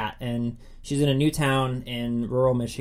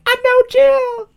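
high squealing fan cheer, much louder; mock cheering; 10 dB louder, slower than usual. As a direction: cheer like a starstruck fan in the crowd. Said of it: "just, like, cheering".

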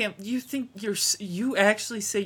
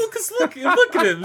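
laughing; 12 semitones higher, 7 dB louder than usual. Real, not acted. Laughing defense of beating villain.

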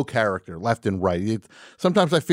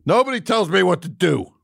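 mock defiance; 10 semitones higher, 5 dB louder than usual. Repeating defiant declaration.

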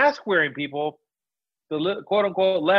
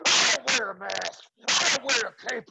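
gravelly Hulk-style promo, brother; wrestler voice. Acted. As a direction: growl it like a pro wrestler. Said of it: "You look like a wrestler".

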